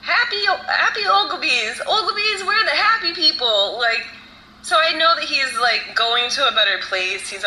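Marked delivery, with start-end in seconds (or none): 0.0-3.8 high, singsong retelling of grandfather; imitating grandfather. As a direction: chant it lightly in a high, put-on voice. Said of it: "He just kept saying".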